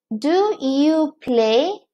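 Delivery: slow, careful classroom example; teacher modelling a question slowly; slow model sentence. Shown as a demonstration. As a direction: lay each word out slowly for learners. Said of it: "teacher models example question".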